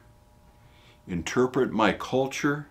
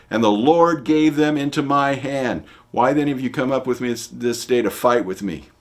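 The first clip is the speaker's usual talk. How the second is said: loud, fast, higher protest; indignant retort; 6 semitones higher, 8 dB louder than usual. Retold or imitated. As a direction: fire back at the accusers. Jephthah answers Ephraimites.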